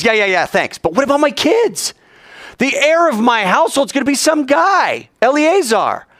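high, complaining voice; whiny Abraham. Acted. Abraham whining to God about his kids, high. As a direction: complain like an ungrateful man.

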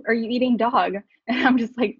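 ordinary talk, nothing marked

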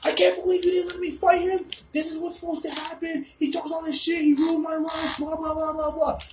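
high-pitched mock scream of outrage; Mikhail screaming. Furious friend outside at four a.m., high mock screaming. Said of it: "Mikhail's screaming at the top of his lungs".

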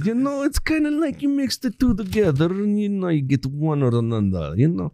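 put-on jokey mixed Russian-Arabic accent; fake Russian-Arabic accent. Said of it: "what fucked up accent that is".

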